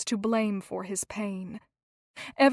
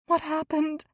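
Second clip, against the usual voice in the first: pleading; 6 semitones higher than usual. A narrator's reading. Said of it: "she pleaded with him".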